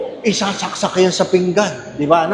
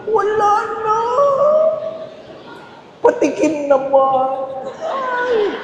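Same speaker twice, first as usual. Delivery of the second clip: high, whiny plea for a taste; stuffed guest begging neighbor for a taste, high and whiny; begging greedy guest; 3 dB quieter, slower than usual. Acted. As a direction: whimper for a bite.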